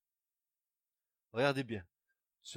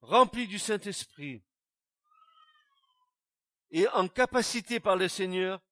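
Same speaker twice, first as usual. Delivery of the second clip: fiery preaching; 4 semitones higher, 8 dB louder than usual. Acted. Preacher urging the congregation toward Jesus, loud fiery preaching. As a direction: proclaim it to the whole church.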